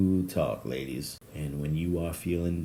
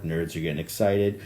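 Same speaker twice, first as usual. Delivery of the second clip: ordinary talk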